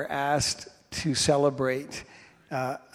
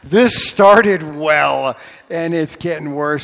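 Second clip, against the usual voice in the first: exasperated cry; 8 dB louder, slower than usual. Retold or imitated. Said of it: "I'm like".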